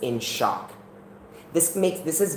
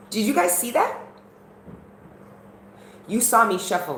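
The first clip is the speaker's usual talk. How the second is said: high, louder amazed question; excited disbelief; 11 semitones higher, 4 dB louder than usual. Real, not acted. Tarot reader amazed, asking viewers if they saw the shuffle. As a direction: marvel at the card that just came out.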